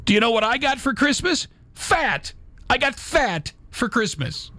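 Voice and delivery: high, squeaky complaining cat voice; whiny cat voice. Acted. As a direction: squeal it like a sulking cat who got fat. Voicing cat in a meme.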